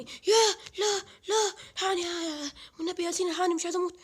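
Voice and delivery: high-pitched, whimpering plea for help; panicked pleading. Real, not acted. Player begging Yassin to save him, panicked pleading. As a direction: beg to be saved in a high voice.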